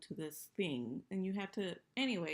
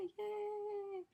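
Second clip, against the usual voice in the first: high sarcastic yay; mock cheer. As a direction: fake cheer. Mocking Timothy's happy news.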